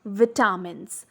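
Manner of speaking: "vitamins" wrongly said as vi-TAH-mins; wrong "vitamins", open AH in second syllable; mispronunciation. Shown as a demonstration. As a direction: say "vitamins" with short I and long AH in the middle. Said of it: "is never pronounced as vitamins".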